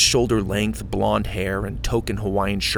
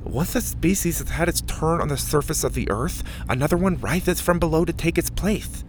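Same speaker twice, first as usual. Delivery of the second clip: put-on higher lisping kid voice; Mac's voice; 6 semitones higher than usual. A narrator's reading. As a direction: keep Mac's lisp going.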